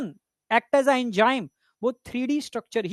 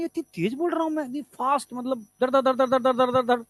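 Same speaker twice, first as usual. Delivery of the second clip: mock fast talking. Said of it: "मैं बोलता भी बहुत तेज तेज हूँ".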